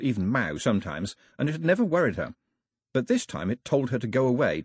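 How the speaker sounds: ordinary delivery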